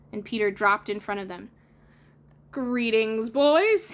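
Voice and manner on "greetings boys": cheerful cry. Acted. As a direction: hail the boys. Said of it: "he cried".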